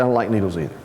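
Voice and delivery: deep, confiding aside; low reassurance. Retold or imitated. Big inmate admits his own fear, low and reassuring. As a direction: confide it gently, well down in pitch.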